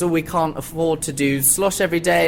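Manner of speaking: ordinary delivery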